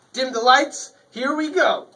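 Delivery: loud, high showman call; showman announcement. Acted. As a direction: hype up the crowd. Starting the show with fanfare.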